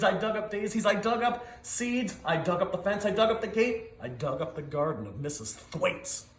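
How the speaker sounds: ordinary delivery, nothing marked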